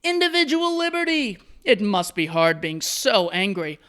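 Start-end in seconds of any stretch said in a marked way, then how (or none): 0.0-1.4 much higher, grand mocking payoff; mock dramatic reveal. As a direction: deliver it as a ridiculous punchline. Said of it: "oh, wait for it".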